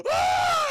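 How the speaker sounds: very high, loud scream of terror; shrill scream. Retold or imitated. Meg screams as Paul is pulled away. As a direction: let out a piercing scream.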